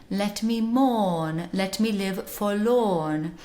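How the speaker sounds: slow Received Pronunciation, no R in mourn or forlorn; British RP with long open vowels, R dropped; modern British accent. Shown as a demonstration. Teacher showing how we would sing it, British RP. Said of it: "in a modern British accent, received pronunciation".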